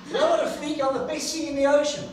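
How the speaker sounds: high, loud boastful retort; proud sea dragon. Acted. Sea dragon boasting back to the seahorse, loud and proud. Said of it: "he's looking at his cousin and saying".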